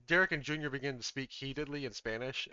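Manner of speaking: ordinary talk, nothing marked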